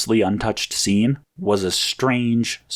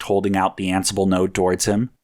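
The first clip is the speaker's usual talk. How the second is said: plain reading voice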